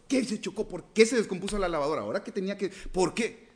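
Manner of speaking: hammed-up frustrated whining; mock frustrated complaint. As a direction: rail at the broken washer like a fed-up believer. Complaining believer voiced in sermon.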